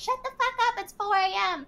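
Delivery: very high, restrained imitation of screaming; mock scream. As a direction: mimic her yelling without any real volume. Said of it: "she starts screaming".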